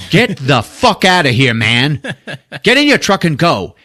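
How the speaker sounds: mock-furious order to clear off; angry guard yelling. Night guard to a man at the gate, irate yelling.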